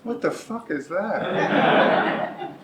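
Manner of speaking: high, slow disbelief with a laugh; baffled, laughing at the strange word; amused disbelief; incredulous. Retold or imitated. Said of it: "And I'm like".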